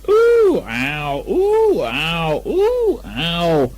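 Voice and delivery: bob up and down with the sound; squeaky piglet ooh and ow; bouncing ooh ow. Acted. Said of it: "he was saying".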